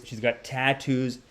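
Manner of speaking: ordinary delivery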